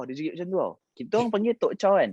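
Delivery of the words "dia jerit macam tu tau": usual speaking voice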